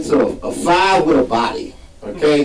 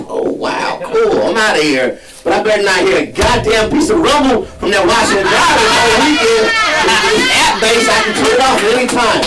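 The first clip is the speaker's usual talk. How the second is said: petty sarcastic threat; 6 semitones higher than usual. Retold or imitated. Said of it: "I was like".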